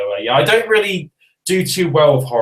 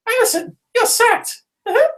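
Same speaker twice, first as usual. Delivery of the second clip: much higher cartoon mouse voice with a smug uh-huh; Mickey Mouse. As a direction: squeak it like an evil cartoon mouse boss. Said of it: "That's my Mickey Mouse impression".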